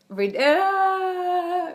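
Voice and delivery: high, pitiful whimper; whimpering sound. Retold or imitated. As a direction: whine like she's about to cry. Sad about having a boy.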